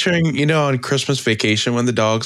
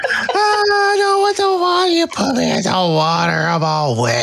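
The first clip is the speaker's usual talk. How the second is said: wailing cat in a bath; 3 dB louder than usual. Retold or imitated. Retelling the cat's fury at being washed, wailing. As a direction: howl each word long and high in dramatic protest.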